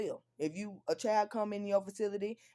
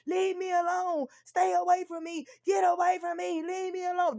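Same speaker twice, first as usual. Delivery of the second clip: put-on child screaming; 9 semitones higher, 4 dB louder than usual. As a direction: scream like the frightened little boy. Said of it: "that's the tone that he used".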